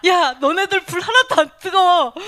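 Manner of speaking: loud, high mocking yell at the captors; taunting shout. Martyr taunting the guards from the fire, loud and jeering. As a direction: jeer at them from the flames. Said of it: "이러면서".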